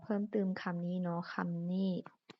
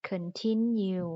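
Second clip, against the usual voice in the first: continue slowly with a louder TIN; stress on TIN; 5 dB louder, much slower than usual. Shown as a demonstration. Teacher models the stressed syllable, continue with TIN punched. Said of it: "มันเน้นเสียงบนทิน".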